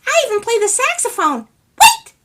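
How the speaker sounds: tiny man bursting with news; excited little man. Acted. Little man telling neighbor he plays, high tiny voice.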